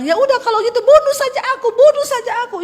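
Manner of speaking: high, loud, fast shriek; wife screaming at her husband in a quarrel. Acted. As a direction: hurl it at the husband in a rage. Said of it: "istrinya bilang".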